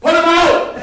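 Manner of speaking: high, loud shouted command. Acted. Teacher showing a director yelling at the actress, high loud shout. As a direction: bark the order out big. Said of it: "I'm gonna go".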